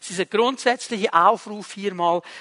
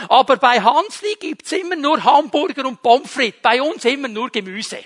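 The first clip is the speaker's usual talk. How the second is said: louder, higher mock whine of a child; complaining child; 5 semitones higher, 6 dB louder than usual. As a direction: play the kid who is sick of vegetables. Child complains about mother's menu. (Kind beschwert sich bei Mami über das Essen, gespielt quengelnd)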